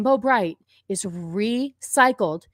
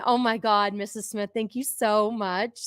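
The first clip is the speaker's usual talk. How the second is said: ordinary talk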